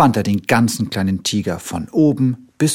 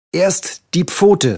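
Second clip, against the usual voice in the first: higher, louder, slow order; higher commanding cub voice; bossy little tiger; 8 semitones higher, 4 dB louder, slower than usual. A narrator's reading. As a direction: order the doctor around. Tiger directs the bandaging.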